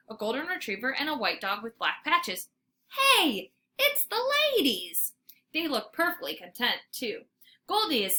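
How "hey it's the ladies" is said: excited. A narrator's reading. Dog spots friends.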